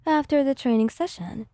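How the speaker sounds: plain reading voice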